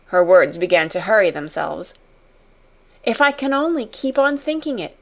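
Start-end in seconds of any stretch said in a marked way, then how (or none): 3.0-5.0 high, mildly impassioned outcry; lightly acted excitement; crying out. A narrator's reading. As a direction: read it as an eager cry, pitched up. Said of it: "she cried".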